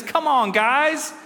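high, slightly slowed urging; rally the group with faith; rallying plea. Retold or imitated. Preacher voicing Caleb urging the people on, rallying. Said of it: "beautiful heart of faith, and he says".

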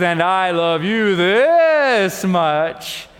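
very slow, full and tender father voice; a dad gently outdoing his son; slow, loving daddy bunny, savoring each word. Acted. Daddy bunny's tender final answer.

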